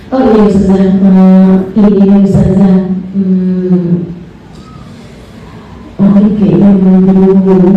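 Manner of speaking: low, halting, unsure voice; low hesitant guest who backs down; hesitant visitor. Retold or imitated. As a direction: stammer and back out of it. Visitor stalls, can't say it.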